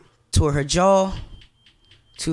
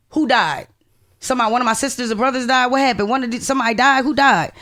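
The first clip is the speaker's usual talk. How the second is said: frantic questions; 6 semitones higher than usual. Retold or imitated. Teen on the phone begging her grandma to say who died, frantic. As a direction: rattle off the questions in rising panic. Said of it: "So I'm saying".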